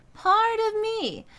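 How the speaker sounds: slowly spoken high in head register. Shown as a demonstration. Coach showing the right relaxed high speech. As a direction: speak it high and easy. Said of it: "We wouldn't speak it that way".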